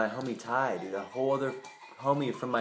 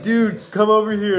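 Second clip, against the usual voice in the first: calling over; 8 semitones higher, 10 dB louder than usual. Retold or imitated. Riley calls him over.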